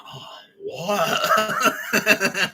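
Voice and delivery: high gasp breaking into laughter; mock shock. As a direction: imitate a stunned reaction while cracking up. Laughing imitation of shocked reaction.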